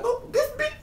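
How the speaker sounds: comic high-pitched annoyance; mock exasperated squeal. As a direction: squeak out reluctant agreement. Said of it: "I was like".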